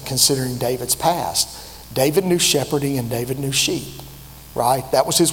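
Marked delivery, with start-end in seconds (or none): none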